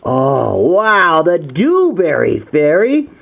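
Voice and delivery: ordinary delivery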